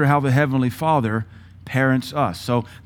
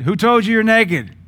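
much higher, louder call for Adam; God calling out; 10 semitones higher, 6 dB louder than usual. Retold or imitated. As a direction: call across the garden to find him.

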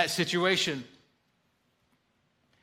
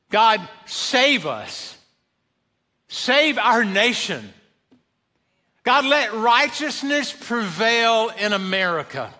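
earnest prayer; 3 semitones higher than usual. Real, not acted. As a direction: pray it aloud, each word weighed. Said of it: "that should be our prayer".

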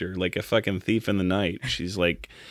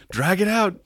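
high put-on voice urging him on; egging a forgetful co-star along, pitched high; mock coaching; 10 semitones higher than usual. Actor prompts the stalled judge, mock high urging. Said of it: "Daniel Day-Lewis was motioning".

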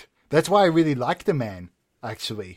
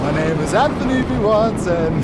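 grand stagey announcement, louder and higher; theatrical actor voice; 6 semitones higher, 6 dB louder than usual. Acted. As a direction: introduce yourself like a luvvie actor. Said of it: "when the voice starts happening".

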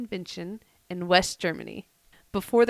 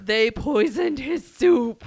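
slow, higher and louder reveal; dramatic confirmation; 5 semitones higher, 6 dB louder, much slower than usual. Real, not acted. Host confirms co-host's guess about the poisoning, dramatic confirmation. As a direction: draw out each word for mock drama.

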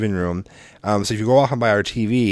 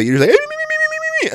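imitating whimper; much slower than usual. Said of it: "it sounds like Beaker".

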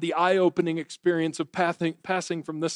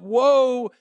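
crying out; 6 semitones higher, 4 dB louder, much slower than usual. Acted. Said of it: "he cries out".

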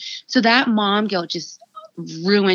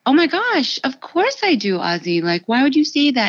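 high-pitched, heartfelt protest; taken-aback mother; 6 semitones higher than usual. Retold or imitated. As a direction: reassure a little child, voice lifted in dismay. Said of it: "And I was".

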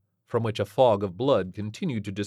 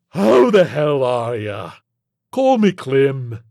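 loud, drawn-out, higher monster greeting; hearty alien creature; 5 semitones higher, 11 dB louder, slower than usual. Acted. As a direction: greet them with brash familiarity.

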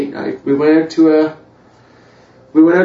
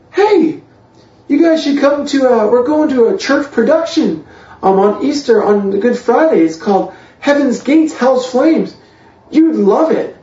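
higher-pitched, coaxing mother voice; imitating inviting mom; 8 semitones higher than usual. Mom inviting son's new girlfriend to a church show, imitated enthusiasm. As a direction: pitch it up like a mom selling an outing.

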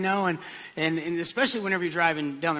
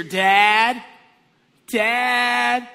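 loud, drawn-out call for a parent; higher, sustained kid's holler; child calling out; 5 semitones higher, 11 dB louder than usual. Acted. Child lost in a store calling to parent, hollering. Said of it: "a child calling out to you".

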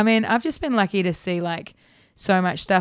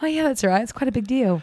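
mock casual understatement; 4 semitones higher, faster than usual. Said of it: "And I'm like".

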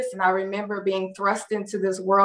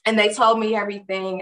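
ordinary talk, nothing marked

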